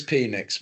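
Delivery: Penix said as PEE-niks; long-e pronunciation. Real, not acted. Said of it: "the pronunciation of that".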